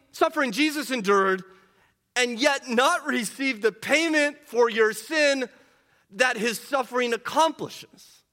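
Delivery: preaching at full force. Acted. Pastor pleading with congregation, impassioned full-force preaching. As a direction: drive the point home like a revival preacher.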